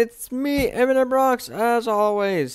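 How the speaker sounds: high, loud YouTuber greeting; bright channel-opener energy; hyped intro. Acted. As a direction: announce yourself to the viewers, pumped up.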